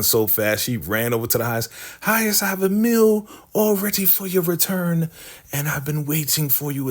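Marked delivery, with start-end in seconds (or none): 2.0-6.9 high, put-on eager servant voice; fawning Elder Mother. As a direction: gush over him to win favor. Elder Mother fawning over the Highest.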